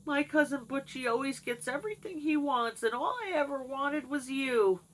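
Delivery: lightly imitating dad's pleading; pleading father. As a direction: beg like a heartbroken suitor. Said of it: "he begged her. He cried".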